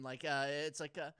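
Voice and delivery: ordinary talk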